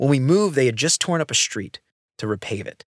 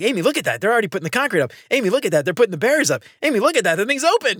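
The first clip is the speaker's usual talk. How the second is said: excited; 8 semitones higher, much faster than usual. Retold or imitated. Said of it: "I'm like".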